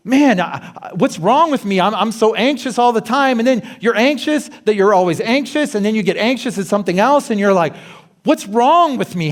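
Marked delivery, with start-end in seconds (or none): 0.1-3.4 fretful self-talk, pitched up; a hint of panicky self-questioning; anxious inner voice. Voicing anxious inner thoughts. 8.2-9.3 exasperated, pitched-up self-talk; mock panicky self-questioning; anxious inner voice. Voicing anxious inner thoughts.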